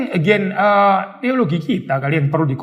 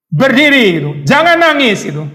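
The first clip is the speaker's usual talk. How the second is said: higher, louder mock scolding of a fallen child; stern parent; 6 semitones higher, 5 dB louder than usual. Preacher voicing a parent scolding a crying child, stern. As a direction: bark the order to get up like a hard parent.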